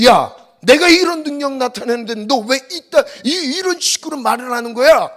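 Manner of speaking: loud, high rebuking shout as God; God scolding the grumbling Israelites, shouting; God's rebuke. Acted. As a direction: rebuke them loudly from above. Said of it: "하나님 할 말 많았어요".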